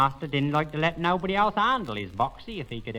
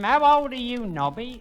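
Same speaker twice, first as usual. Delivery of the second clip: put-on raised, high calling voice to a deaf old man; 11 semitones higher, 7 dB louder than usual. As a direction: call it out so he hears.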